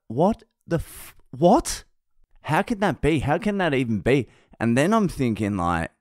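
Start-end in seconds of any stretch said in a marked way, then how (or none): 0.1-4.3 high-pitched, stunned questions; incredulous. Retold or imitated. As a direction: act out a dad's baffled disbelief. Acted disbelief at daughter's crush.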